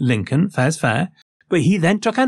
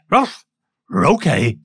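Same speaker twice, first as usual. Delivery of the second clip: dog's voice; slower than usual. Retold or imitated. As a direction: answer like a pet dog, one yap then okay. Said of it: "said Boy".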